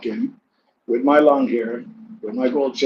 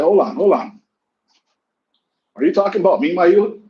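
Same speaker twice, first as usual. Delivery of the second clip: high, loud heated retort at the barber; heated comeback; 6 semitones higher, 3 dB louder than usual. Retold or imitated. Retelling telling off the chatty barber, high and heated. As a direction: go off on him, rising and loud.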